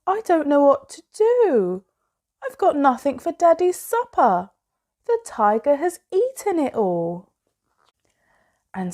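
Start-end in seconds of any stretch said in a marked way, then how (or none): 0.0-7.3 higher, louder fretful mother's voice; a mum at a loss over the empty kitchen; worried mummy. A narrator's reading. Mum frets to Sophie about Daddy's supper, higher and louder. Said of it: "Sophie's mummy said".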